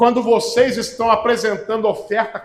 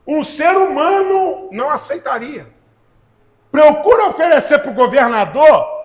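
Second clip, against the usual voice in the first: pregação inflamada; 4 semitones higher, 4 dB louder than usual. Acted. Preacher voicing God in Malachi.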